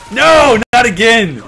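high, loud protest; loud dismay. Real, not acted. Gamer protesting another death, loud dismay. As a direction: yell it out. Killed again.

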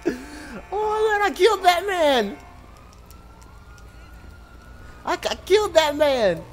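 horrified but laughing at himself; high disbelieving cry; shocked yelp. Real, not acted. Runs someone over in the game.

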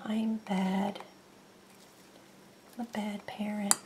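guilty little whisper. Real, not acted. Owner whispering guilt over skipped vet shot. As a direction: confess it under your breath.

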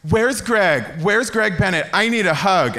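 high, loud pleading for a hug; imitating Ashley. Teller mimics Ashley begging for a hug, high and loud. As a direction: mimic her wailing for Greg. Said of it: "I remember Ashley going".

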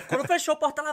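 normal talking voice

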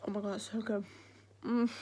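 ordinary talk, nothing marked

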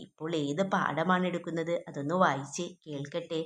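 hushed, dreamlike request; dreamy murmur. A narrator's reading. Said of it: "സ്വപ്നത്തിലെത്തും പോലെ പറഞ്ഞു".

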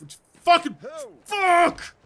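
loud, high burst of swearing; frustrated shout. Real, not acted. Player stuck in the roof after dying, frustrated. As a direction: explode in real frustration.

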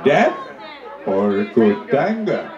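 very low, slow chief question; deep chief voice. Acted. Chief asking the second missionary, very low and slow. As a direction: drag out the choice ominously low.

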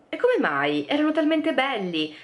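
a touch of a gushing friend; lightly voiced fussing girl; [name]'s dismay.

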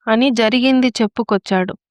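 usual speaking voice

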